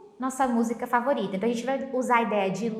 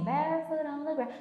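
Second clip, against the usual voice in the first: lightly British barefoot on the grass, grass with an open a; British accent; 4 semitones higher, 4 dB quieter, faster than usual. Shown as a demonstration. Teacher imitates the singer's British pronunciation again. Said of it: "Acho que ele fala assim".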